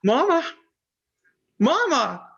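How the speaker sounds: high, surprised call of mama; overjoyed disbelief; astonished joy. Acted. Son seeing his missing mother at the door, high astonished call.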